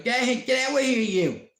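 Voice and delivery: high, loud, furious get-out; angry Irish woman. Retold or imitated. Man imitating an Irish woman throwing a man out, high and loud. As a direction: bark it out like a fed-up Irish wife. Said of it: "she'd be like".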